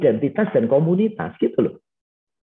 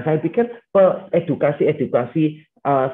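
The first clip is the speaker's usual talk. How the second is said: usual speaking voice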